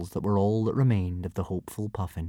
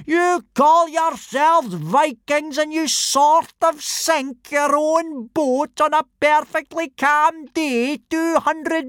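slow, much higher and louder ranting; angry roar; 5 dB louder, slower than usual. Acted. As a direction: tear into the boys. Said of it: "roared Gobber".

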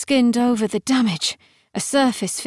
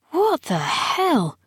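drawn-out, high-pitched outrage; indignant objection; 7 semitones higher, slower than usual. A narrator's reading. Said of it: "objected Gideon".

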